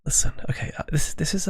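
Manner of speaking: breathy ASMR whisper, close to the mic. Shown as a demonstration. Streamer whispers to chat to start soft ranting. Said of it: "this is A S M R".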